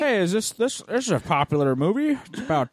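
clueless moviegoer voice. Pretend. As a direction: play the confused newbie.

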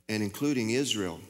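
usual speaking voice

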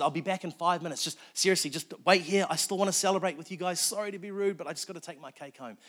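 high, loud, urgent imitation of a pleading woman; frantic insisting. Agnes leaving the party with her cake, acted high frantic insistence. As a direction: act out a flustered, insistent plea to wait.

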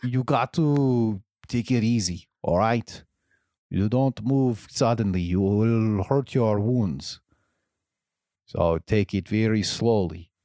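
put-on wandering accent, Austrian drifting into Russian; Arnold impression. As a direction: attempt a gentle Austrian action-hero accent. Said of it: "let me get the voice".

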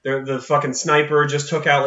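ordinary talk

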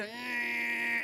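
high, sustained drill whine; drawn-out imitation of a whining drill; dentist drill noise. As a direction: imitate a drill with one long high note. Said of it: "that drill, when you hear".

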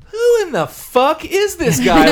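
high, slow, laughing disbelief; baffled crowd. Retold or imitated. Dead fans baffled by the opener, high laughing mock outrage. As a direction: stretch it out in mocking disbelief. Crowd voiced confused at [name].

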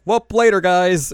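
high, loud breezy goodbye; breezy villain farewell. Retold or imitated. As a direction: toss off a cheery see-ya. Villain leaving via portal.

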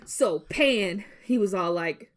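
normal talking voice

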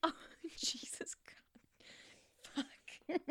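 hushed laughing whisper. Real, not acted. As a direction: whisper it through a stifled laugh. Stifled laugh while recording.

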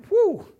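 high, loud "woo"; exultant whoop. Real, not acted. Preacher exulting in surrender, exultant whoop. Exclaims over act of surrender.

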